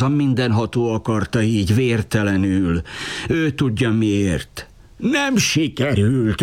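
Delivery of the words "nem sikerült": higher, slow, raging wail. A narrator's reading. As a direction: rage at the failure, drawn out. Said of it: "őrjöngött az Alabarkosz".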